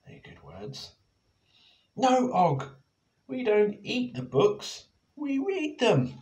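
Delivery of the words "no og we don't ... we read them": high, loud telling-off; Penny scolds Og, high and loud; scolding. Acted. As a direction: stop him eating the books. Scolding Og in library.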